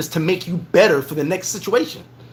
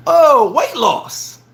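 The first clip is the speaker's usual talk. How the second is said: high, loud and drawn way out; excited pitch; 6 semitones higher, 4 dB louder, much slower than usual. Acted. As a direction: stretch it like a big reveal. Pitching his weight-loss niche.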